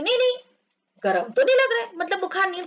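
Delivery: high, reassuring mother voice; high mother's voice saying no fever; mother checking. Acted. Mother feeling a child's forehead, high and reassuring.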